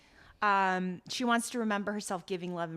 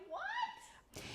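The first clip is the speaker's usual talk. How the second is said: very high, soft squeak of disbelief; baffled reaction; 13 dB quieter than usual. Retold or imitated. Viewer reacting to the sobbing scene, high incredulous squeak.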